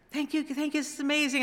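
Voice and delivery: high-pitched, overcome thanks, lightly acted; imitated happy tears. Kids receiving instruments, imitated tearful happy thanks. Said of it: "they're crying, and they're happy".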